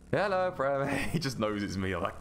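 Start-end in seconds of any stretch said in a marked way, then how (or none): 0.1-1.1 louder, higher knowing greeting; imitating Alex. Alex unfazed by the scare attempt, lightly mocked smug hello. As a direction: greet him smugly, as if you saw it coming. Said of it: "And he's like".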